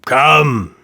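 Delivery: impatient king repeating himself. A narrator's reading. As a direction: press on, a little flatter. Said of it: "said the king impatiently".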